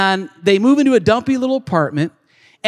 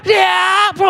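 mock battle yell; 8 semitones higher than usual. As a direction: yell it while blocking. Retold sparring match, shouted.

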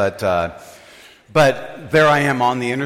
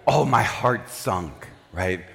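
ordinary talk, nothing marked

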